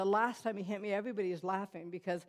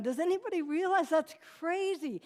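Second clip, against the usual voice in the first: high, loud exasperated outcry; wanting to scream; 8 semitones higher, 5 dB louder than usual. Retold or imitated. Woman wanting someone to see she's being played, near screaming. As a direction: appeal to the whole room, voice raised. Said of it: "wanted to scream that at someone".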